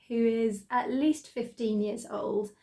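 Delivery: ordinary delivery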